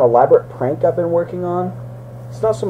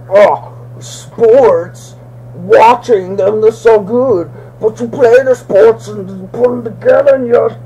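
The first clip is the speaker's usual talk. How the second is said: mock sports fan; 6 semitones higher, 4 dB louder, slower than usual.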